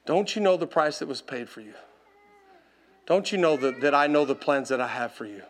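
low, soft, solemn question; Holy Spirit's voice. Retold or imitated. Holy Spirit speaking to the preacher in that moment, hushed. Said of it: "The Holy Spirit was just like".

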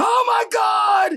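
high, drawn-out agonized yell; hammed-up high scream; screaming bloody murder. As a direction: imitate a man crying out in sudden pain. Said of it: "bloody murder comes out of his".

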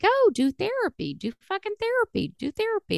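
high-pitched mock chorus of advice; everybody pushing advice, put on high; imitated nagging. Everybody nagging her, retold.

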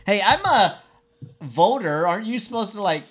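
high, loud mock-indignant voter; hammed-up movie-line quote; quoting a film character.

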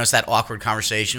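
ordinary delivery

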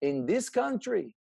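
put-on slow, lightly French-accented dad. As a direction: gently mimic his father's French accent. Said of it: "he'd use his little accent and he'd say it like this".

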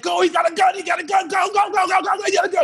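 high-pitched, frantic escape cry; panicked yelling. Retold or imitated. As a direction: yell urgently to get the driver moving. Re-enacts fleeing from a gun.

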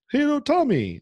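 exaggerated innocent hello to Tommy; mock cheery greeting. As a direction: imitate him acting like nothing happened. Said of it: "like, he's just, like, normal".

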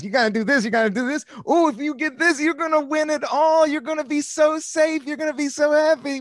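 high, tempting inner ego voice; the ego's voice. Retold or imitated. Ego voice tempting a comedian with success, high and eager. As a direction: coax like a pushy little ego. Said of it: "we have the ego that goes".

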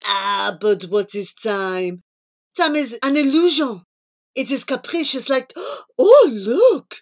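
French philosopher-lover cut short by a gasp; Jean-Claude. Acted. Jean-Claude philosophising on time, then excitedly spotting something. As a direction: muse grandly, then gasp with loud excitement.

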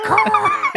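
very high, loud mock seabird screech with a laugh; high seagull caw. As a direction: squawk it like a gull, high and loud. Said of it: "one of the seabirds going".

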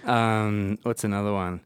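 ordinary delivery

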